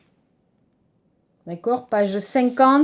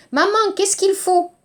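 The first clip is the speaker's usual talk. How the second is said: put-on child asking; 8 semitones higher than usual. Child asking mom at supermarket.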